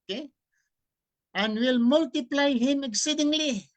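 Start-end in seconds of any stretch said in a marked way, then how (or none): 1.3-3.7 scripture reading. Acted. Preacher reading the Bible verse aloud to listeners, solemn declaiming.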